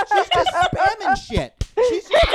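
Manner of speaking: high, loud comic rant, slowed for punch; exasperated shouting. Acted. Comedian debunking the talking gorilla, high exasperated shout. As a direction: shout it in mock outrage, half laughing.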